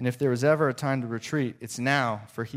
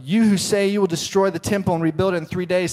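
a higher, loud jeering crowd; mocking shout; 7 semitones higher, 6 dB louder, faster than usual. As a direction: taunt him from below the cross. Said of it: "the people shouted".

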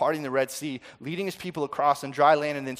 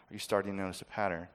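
wry aside; 7 semitones lower, 8 dB quieter, faster than usual. Real, not acted.

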